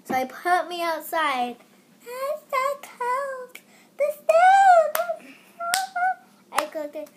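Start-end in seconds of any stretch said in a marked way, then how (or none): 2.0-5.3 put-on high, very slow little-kid whine; hammed-up cold baby voice; mock toddler shivering. Baby self freezing in snow.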